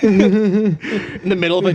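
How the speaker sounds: slow words through real laughter; laughing. Real, not acted. Reacting to the co-host's joke, laughing. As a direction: laugh while getting the words out.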